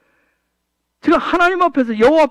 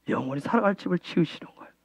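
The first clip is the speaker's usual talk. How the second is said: much softer, quicker repeat, almost to himself; quiet fast echo; 6 semitones lower, 13 dB quieter, faster than usual. Real, not acted. Pastor murmuring his point again to the congregation. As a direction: drop it away in a rapid murmur.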